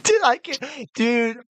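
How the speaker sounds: high, breaking with laughter; can barely talk from laughing. Real, not acted. Laughing hard.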